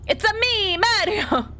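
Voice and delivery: high, exuberant mock Italian catchphrase; Mario. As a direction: burst out in a high cartoon Italian voice. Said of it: "if you had come up and you had said".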